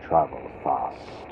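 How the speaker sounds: usual speaking voice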